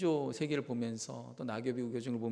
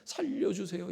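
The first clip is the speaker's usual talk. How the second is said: trembling plea; 6 semitones higher than usual. Retold or imitated. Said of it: "부들부들 떨면서".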